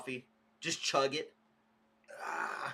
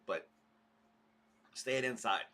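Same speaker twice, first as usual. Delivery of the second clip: normal talking voice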